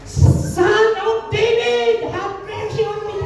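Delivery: very high, long-drawn imitated wail of a call; Bartimeo's intensified cry. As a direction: stretch the plea out high, as if straining to be heard. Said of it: "Lalo pa niyang inilakas ang sigaw niya". (ginayang mataas at mahabang sigaw)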